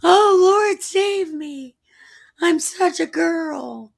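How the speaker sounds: put-on very high, girlish squeal for help; hammed-up falsetto panic; mock frightened cry. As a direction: shriek it like a comic coward. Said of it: "he cried out".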